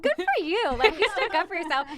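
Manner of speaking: high, loud congratulations; imitating proud cheering. Parents high-fiving their timid daughter, loudly proud. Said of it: "like, high-fiving".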